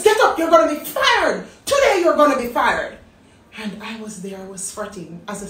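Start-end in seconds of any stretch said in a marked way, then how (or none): 0.0-3.0 louder, higher furious threat; angry boss. Retold or imitated. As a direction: threaten like an enraged supervisor. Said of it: "He says".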